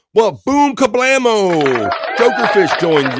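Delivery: big drawn-out hype call; excited shout. Real, not acted. Host hyping the chat arrival, booming. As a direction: blast it out like an announcer.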